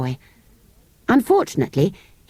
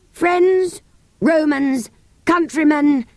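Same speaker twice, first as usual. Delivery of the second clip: high, grand declaiming schoolboy; hammed-up speech from a water butt; mock oration; 8 semitones higher, much slower than usual.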